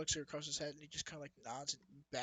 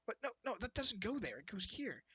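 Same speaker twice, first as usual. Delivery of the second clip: higher-pitched fussy objection; lightly voiced little busybody correcting someone; a hint of a small, put-out voice; little background voice; 7 semitones higher than usual. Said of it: "just faint little voice going".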